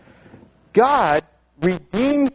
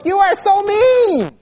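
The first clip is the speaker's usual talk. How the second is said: high, drawn-out indignant yell; hammed-up accusing holler, pitched way up; mock kids' yell; 5 dB louder, slower than usual. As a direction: imitate an outraged child yelling, stretching the words. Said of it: "they would yell at us".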